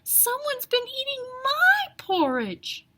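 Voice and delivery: an upset mother bear; Mama Bear crying out. A narrator's reading. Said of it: "cried Mama Bear".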